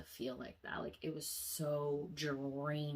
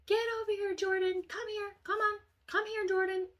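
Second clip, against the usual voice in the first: very high, loud scolding call; mom calling out; 7 dB louder than usual. Retold or imitated. Mom calling Jordan away, loud high-pitched scolding. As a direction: imitate a mother yelling for her child.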